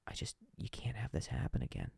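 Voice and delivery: conspiratorial whisper; hushed whisper. Retold or imitated. The client whispering a warning over the phone. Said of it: "he got real quiet".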